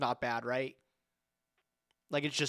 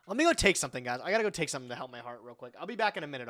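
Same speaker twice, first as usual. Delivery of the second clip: usual speaking voice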